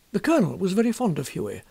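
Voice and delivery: plain reading voice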